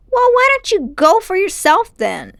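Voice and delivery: put-on high, loud boyish retort; a boy daring his uncle to go himself; boy's challenge. A narrator's reading. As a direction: pitch it up like a cheeky boy.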